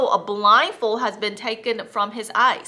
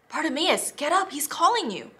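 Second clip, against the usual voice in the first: higher, eager call to the beggar; excited; 6 semitones higher than usual. Acted. Crowd excitedly tells the beggar Jesus calls, higher voice. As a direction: tell him the news eagerly. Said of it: "the people said excitedly".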